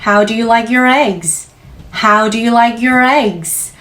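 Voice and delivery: unhurried, clear server's question; slow waiter question. Shown as a demonstration. Teacher playing a waiter asking the guest about eggs. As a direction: say it slowly, like a friendly waiter. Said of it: "계란 요리 어떻게 드릴까요".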